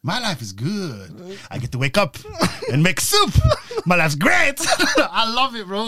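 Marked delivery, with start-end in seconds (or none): 0.0-4.5 slow start, building louder and higher; Nacho. Retold or imitated. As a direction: declaim it as a hammed-up comic wrestler. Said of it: "I make Nacho reels all the time".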